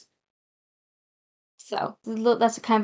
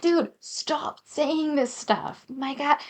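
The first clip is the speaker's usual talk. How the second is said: exasperated; 5 semitones higher, much slower than usual. Real, not acted. Exasperated at the game's writing.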